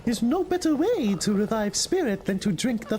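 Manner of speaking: a jolly little flea offering his brew; high flea brewer. Acted. Flea brewer welcoming Miss Hornet to camp, high and jolly.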